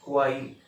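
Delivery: हुआई run together as "hu-AAI", no V sound; common mispronunciation. Shown as a demonstration. Teacher quotes what many people say, wrong pronunciation. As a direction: say हुआई quickly, ending in "ai". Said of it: "बहुत सारे लोग बोलते हैं".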